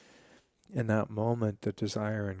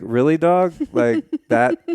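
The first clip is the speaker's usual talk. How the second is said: loud, disbelieving, much higher pitch; incredulous; 5 semitones higher, 11 dB louder, slower than usual. Retold or imitated. Incredulous reply to "we pray.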